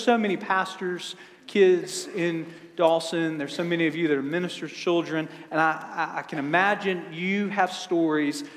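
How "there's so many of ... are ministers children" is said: ordinary talk, nothing marked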